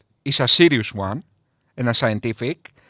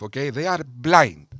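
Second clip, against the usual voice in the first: ordinary talk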